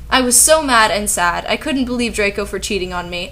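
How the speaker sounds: ordinary delivery, nothing marked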